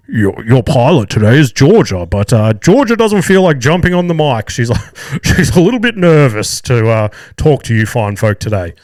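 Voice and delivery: loud mock intercom announcement with a chuckle; muffled cockpit address, laughing mid-sentence; pilot over loudspeaker. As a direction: imitate a co-pilot stumbling amiably over the PA. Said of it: "the pilot comes over the loudspeaker".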